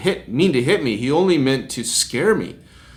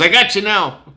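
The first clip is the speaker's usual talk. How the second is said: higher, louder triumphant mock threat; acted gotcha taunt; 7 semitones higher, 5 dB louder than usual. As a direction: imitate someone who has caught you out. Acted gloating driver photographing him.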